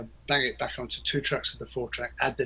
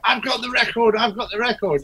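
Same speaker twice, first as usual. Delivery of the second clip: excited caller; 10 semitones higher, 7 dB louder than usual. Retold or imitated. Excited fans phoning the label.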